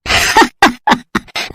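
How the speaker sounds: quiet amused chuckle; soft chuckle. Acted. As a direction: chuckle softly at the girl's promise. Headman laughs at offer. (मुखिया की धीमी हँसी)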